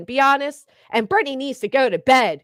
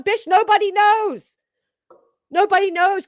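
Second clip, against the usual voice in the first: scornful outburst; 8 semitones higher, 3 dB louder than usual. Real, not acted. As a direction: throw it back at him with disgust. Ranting at Turtle Talk.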